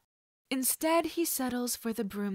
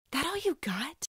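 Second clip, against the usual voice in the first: higher, cocky challenge; taunt; 7 semitones higher than usual. Acted. The boy goading the kidnapper after the blow, cocky. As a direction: goad him on.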